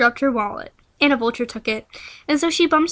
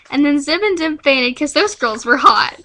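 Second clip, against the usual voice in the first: ordinary talk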